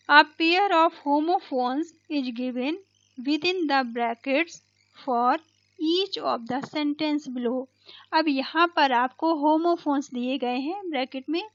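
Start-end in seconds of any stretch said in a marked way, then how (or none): 0.1-7.7 slow, deliberate reading of the exercise line; slow textbook reading. Acted. Teacher reading the instruction to students, slow and deliberate. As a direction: read each word out slowly for the class.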